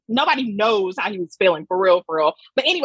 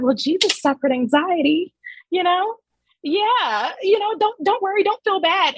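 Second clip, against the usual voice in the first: higher-pitched, glib mock comfort; mock reassurance; 7 semitones higher than usual. Critic parodies the ad's message to anxious people, mock reassurance. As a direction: parody someone brushing off worries.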